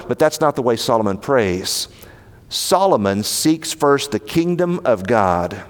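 normal talking voice

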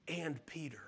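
hushed whisper, almost breathless; a tender whispered aside. Acted. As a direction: lean in and whisper it like a secret. Pastor hushes angel's added words.

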